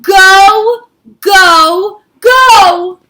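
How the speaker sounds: loud high shout of go; the beaten king yelling them out; Paro shouting go. Acted. Paro finally gives in, shouting loud. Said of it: "Paro shouted".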